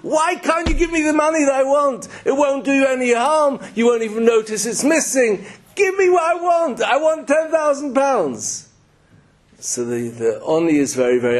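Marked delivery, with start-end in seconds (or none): 0.0-8.7 high-pitched, outraged screaming rant; acted angry yelling. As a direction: yell it like an indignant, demanding beggar. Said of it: "yells at him and screams at him".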